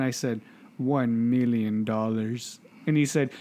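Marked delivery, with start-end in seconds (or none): 0.8-2.6 slow, drawn-out mock-serious answer; deliberately slow prank reply; slow deadpan. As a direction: stretch out each word for comic effect. Prank answer to the debt caller.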